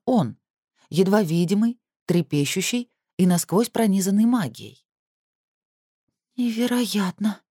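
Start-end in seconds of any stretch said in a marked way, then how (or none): 6.4-7.4 thirsty. A narrator's reading. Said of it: "пересохшими губами прошептала Кассандра".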